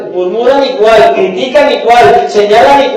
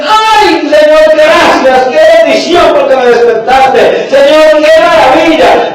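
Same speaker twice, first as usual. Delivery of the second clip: joyful gratitude; 5 semitones higher, slower than usual. Retold or imitated. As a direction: burst out thanking the Lord. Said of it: "entonces dice".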